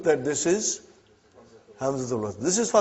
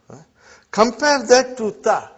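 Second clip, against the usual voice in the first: usual speaking voice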